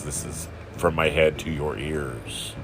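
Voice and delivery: usual speaking voice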